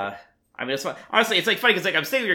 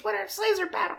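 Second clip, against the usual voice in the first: put-on very high dismissive child; bratty kid voice. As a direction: toss it off like a bored kid. Voicing bored kid.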